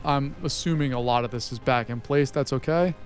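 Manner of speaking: ordinary delivery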